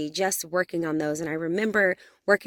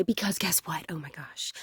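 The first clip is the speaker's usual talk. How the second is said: excited whisper; 6 dB quieter than usual. Real, not acted. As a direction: whisper it like a thrilling secret. Secretly thrilled before revealing guest.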